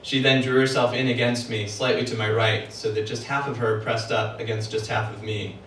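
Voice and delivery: usual speaking voice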